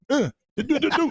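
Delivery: high, quick "do-do-do" punch sounds; imitated punching noises. Son imitating mom fighting off a cousin, playful high punch noises. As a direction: mimic a flurry of punches, pitched high.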